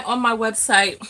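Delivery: ordinary talk, nothing marked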